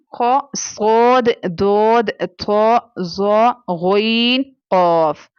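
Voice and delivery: खो सोद दोद थो with a thick, full tone; slow thick recitation of the heavy letters. Shown as a demonstration. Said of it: "हमेशा thicker tone में पढ़ते हैं".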